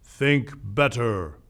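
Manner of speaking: very slow ad tagline delivery; tagline read. Retold or imitated. Presenter mock-quoting Apple's iconic tagline, slow tagline read. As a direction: draw out each word like a slogan. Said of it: "ended with the iconic tagline".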